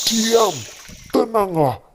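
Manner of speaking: Jesus commanding the storm with authority. Acted. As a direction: order the wind to be still. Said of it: "Dengan kuasanya, dia berkata".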